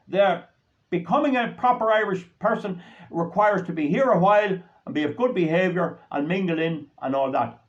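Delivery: ordinary talk, nothing marked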